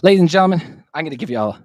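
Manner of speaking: loud, high announcer call. Acted. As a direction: call it out like a show host. Opening the show outro.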